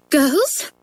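put-on upset teacher rebuking girls; teacher's voice. A narrator's reading. Teacher addressing unruly class.

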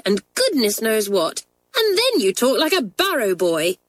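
high-pitched, scornful scolding; in a rage. A narrator's reading. Janet scolding a schoolmate about her speech, enraged. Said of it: "Janet flung down her book in a rage".

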